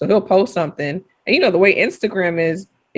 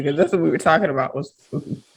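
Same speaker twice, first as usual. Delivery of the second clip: ordinary talk, nothing marked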